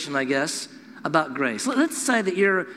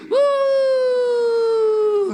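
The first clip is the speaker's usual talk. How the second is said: loud, high celebratory whoop; joyful whoop; 6 dB louder than usual. Acted. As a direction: let out a gleeful high woo. Celebrating grace arriving.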